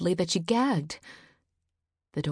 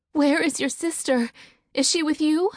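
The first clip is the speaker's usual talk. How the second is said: high, louder anxious hope; hopeful; 10 semitones higher, 5 dB louder than usual. Acted. Mother asking her son about her daughter, high hopeful question. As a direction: ask it clinging to hope. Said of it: "she asked hopefully".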